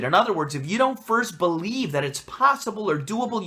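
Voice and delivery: ordinary talk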